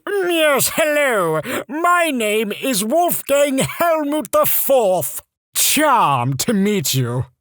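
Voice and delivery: high, slow, posh-mock British accent; bad British accent. Mock British intro to people, high and slow. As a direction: ham up a terrible British accent, slowly. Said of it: "put on the worst British accent you could".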